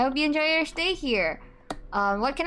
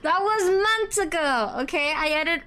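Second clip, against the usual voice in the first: whiny defensive; 6 semitones higher than usual. Acted. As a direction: plead a fake-teary excuse. Defending herself to the chat.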